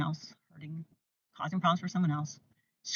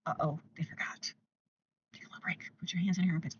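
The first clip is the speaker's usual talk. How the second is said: hushed, dropping to a whisper; soft gentle reminder; 6 dB quieter, faster than usual. Shown as a demonstration. Parent reminding a child's hands, softly whispering. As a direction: coax the child quietly, almost whispering. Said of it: "Tell your hands to take a little break".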